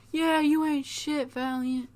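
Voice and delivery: high, hushed pretend shouting; tiny cheering lackeys heard from far back; background hype crew. Pain Alliance backing Paul from behind, high soft mock jeers and cheers. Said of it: "hear the Pain Alliance in the background".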